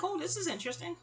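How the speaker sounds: high, soft mock intrigue; light, hushed curiosity; intrigued aside. Amused inner reaction, retold.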